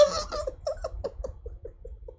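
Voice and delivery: breathless squeaky laugh; high laugh. Real, not acted. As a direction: wheeze with laughter. High laugh after joke.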